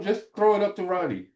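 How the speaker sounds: high, lightly voiced coach's instruction; quoting the coach. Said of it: "'Cause Coach Fry said".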